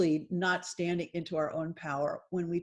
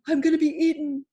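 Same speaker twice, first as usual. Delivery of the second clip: loud, high cry of terror, acted; terrified lion cub; 7 semitones higher, 6 dB louder than usual. Lion cub facing the huge lion, acted terror. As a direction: play a cub sure of being eaten. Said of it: "He was terrified".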